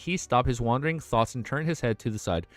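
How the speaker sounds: steady, even read-aloud narration; audiobook-style reading; story narration. A narrator's reading. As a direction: read the novel to chat.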